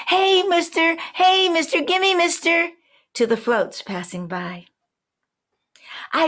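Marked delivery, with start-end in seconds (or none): 0.1-2.7 put-on high, loud, eager child's shout. Little girl at Mardi Gras shouting to the floats, high and eager.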